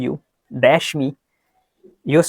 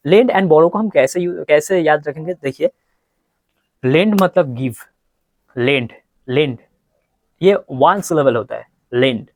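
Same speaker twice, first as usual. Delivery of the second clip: normal talking voice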